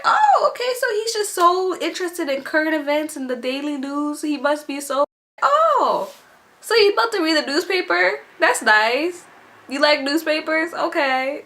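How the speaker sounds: high, sing-song mock admiration; mock him sweetly; making fun of the angry guy grabbing a newspaper, high and syrupy; sarcastic mockery. Sarcastic thoughts about him.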